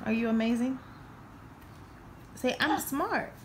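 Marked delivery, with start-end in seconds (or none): 2.6-3.3 coaching a line. Shown as a demonstration. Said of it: "teaching child to repeat".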